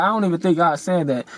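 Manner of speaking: ordinary talk, nothing marked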